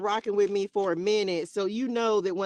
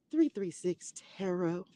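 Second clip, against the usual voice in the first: much softer, timid imitation; unprojected old voice; 3 semitones lower, 6 dB quieter than usual. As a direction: say it small and unprojected. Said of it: "I wasn't even projecting my voice right".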